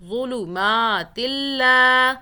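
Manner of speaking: ظلمات اللہ chanted slowly and loud, ما held; Quran recitation. Shown as a demonstration. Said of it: "میم کو کھینچا، لام کو کھینچا".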